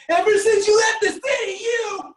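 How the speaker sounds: high, loud, crying shout; angry teen girl wail. Retold or imitated. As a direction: wail like a furious heartbroken teenage girl. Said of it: "like a thirteen-year-old girl full of anger".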